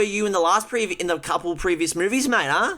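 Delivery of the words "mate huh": ordinary talk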